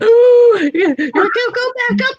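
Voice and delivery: high, loud squeal of delight; laughing, teary excitement; excited squeal. Retold or imitated. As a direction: gush like a starstruck fan. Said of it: "I was like".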